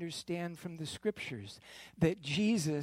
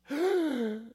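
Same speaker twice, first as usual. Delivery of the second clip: gasp; 8 semitones higher, 5 dB louder than usual. Acted. Reaction after Jesus is handed over.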